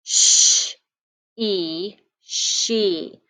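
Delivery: sh hissed alone, then e, then blended to she; tapping out sounds. Shown as a demonstration. Teacher taps out she for young writers, segmenting. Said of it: "let's tap it out".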